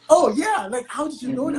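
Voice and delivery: high-pitched mock amazement; retold surprise. Colleague reacting to the speaker's knowing the term, retold surprise.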